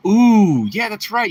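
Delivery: high, loud delighted ooh; excited ooh. Real, not acted. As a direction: burst out with delight. Reacts to viewer's channel.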